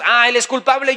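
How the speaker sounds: accusing. Acted. Mimics blaming others.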